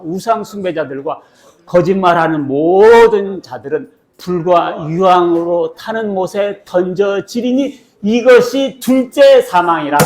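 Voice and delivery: loud, drawn-out climax to the verse; scripture read-aloud. Acted. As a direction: recite the list, then slow down for the solemn sentence.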